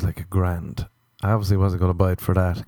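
normal talking voice

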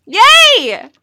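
excited cheer. Real, not acted. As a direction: cheer happily. Cheering living together.

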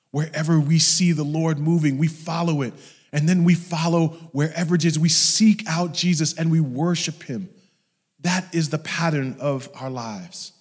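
loud, high, driving sermon delivery; fervent proclamation; impassioned preaching. Real, not acted. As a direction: drive it home to the congregation. Preaches at full force.

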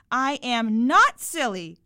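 put-on loud, slow, indignant child's shout; crowing protest. A narrator's reading. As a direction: crow it out, every word stretched. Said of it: "I crowed".

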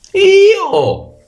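very high, loud hurried bray; donkey bray. A narrator's reading. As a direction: bray it out in a rush to be first. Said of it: "ragliò in fretta l'asino". (raglio acuto e frettoloso)

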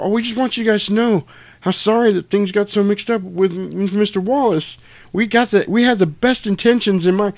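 high, stammering mock nerves; imitating nervous Brett. Brett makes a nervous excuse to Jules, high and stammering. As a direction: squeak out the excuse. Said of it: "He's like".

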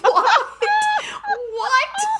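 high, laughing disbelieving yelps; shocked disbelief. Retold or imitated. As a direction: laugh out a stunned what, what. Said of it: "I was like".

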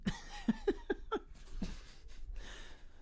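real high, soft laughter; laughing fit. Real, not acted. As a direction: laugh helplessly in high bursts. Listener laughs at the story.

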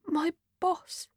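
small, high, soft squeak; tiny voice. Acted. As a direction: shrink to a tiny, mortified peep. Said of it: "in a tiny voice".

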